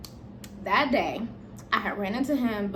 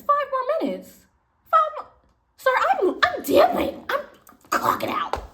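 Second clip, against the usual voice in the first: high, loud disbelief at five more minutes; shocked high outcry; 6 dB louder than usual. Retold or imitated. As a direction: yelp it like you can't believe it.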